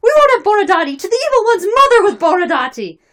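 very high, loud yelling; crowd shouting. Acted. Rival Cossack factions fighting over a nominee, shouting. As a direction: yell like duelling factions at a rally.

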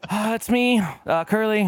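high, hesitant meek reply; sheepish answer. Retold or imitated. Curly answering his boss, high and sheepish. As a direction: answer timidly from the stall. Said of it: "And I'm like".

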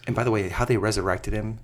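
ordinary talk, nothing marked

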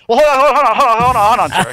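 high, scrambling salesman stammer; mock a rep desperate to keep the caller; flustered phone rep. Phone rep stopping customer from declining, mock flustered.

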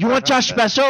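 high imitated Chinese restaurant voice; mock Chinese accent. Friend's mom posing as a restaurant, mock Chinese accent. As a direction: put on a high Chinese accent for taking orders. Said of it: "she would answer as a Chinese restaurant".

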